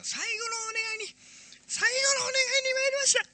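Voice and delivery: high, strained election-car announcer voice; distorted campaign loudspeaker. Retold or imitated. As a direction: shout it like a campaign van on a megaphone. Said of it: "って来るじゃん". (選挙カーのスピーカー風の甲高い呼びかけ)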